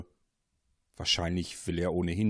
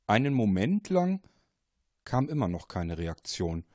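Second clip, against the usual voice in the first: usual speaking voice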